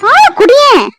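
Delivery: high, loud, exasperated plea; exasperated scolding. Acted. Mother to crying baby who won't drink milk, exasperated scolding.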